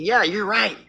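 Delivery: lightly hammed-up fox flattery; fake-friendly fox. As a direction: coo along like a false friend. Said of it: "The wolf isn't gonna be smiling and saying".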